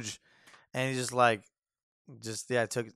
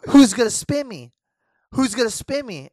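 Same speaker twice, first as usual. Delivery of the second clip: put-on party girl taunt; 10 semitones higher, 11 dB louder than usual. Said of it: "and then she goes".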